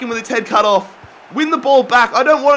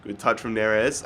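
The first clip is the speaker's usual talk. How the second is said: ordinary delivery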